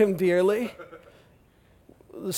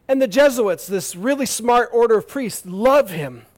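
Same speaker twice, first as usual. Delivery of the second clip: usual speaking voice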